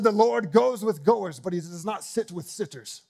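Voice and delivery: put-on high, loud, drawn-out German accent easing off at the end; mock-booming German accent, stretched out. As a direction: imitate a German preacher proclaiming a slogan. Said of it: "in his awesome German accent".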